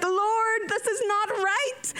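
high, drawn-out plea to heaven; high-pitched mock despair; exasperated plea. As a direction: wail it slowly as a comic prayer. Retold exasperated plea to God.

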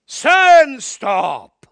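huge commanding shout; booming shout. Retold or imitated. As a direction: boom it out like the voice of God. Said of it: "It sounded like God".

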